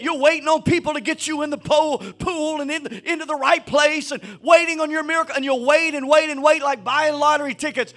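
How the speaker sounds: loud, driving sermon build; preaching at full force. Acted. Pastor urging his church to walk in faith, full force preaching. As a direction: preach it up, pressing on every walk.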